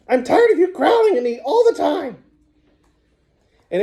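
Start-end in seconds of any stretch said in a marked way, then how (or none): 0.1-2.2 squeaky sock puppet. A narrator's reading. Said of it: "he squeaked".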